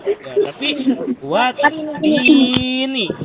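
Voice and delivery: watini stretched very slowly in chant; slow recitation. Shown as a demonstration. Teacher demonstrating kasrah to pupils, slow chant. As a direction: draw out watini like Quran recitation. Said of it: "saya contoh yang kasroh sekarang".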